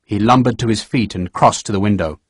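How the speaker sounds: ordinary delivery, nothing marked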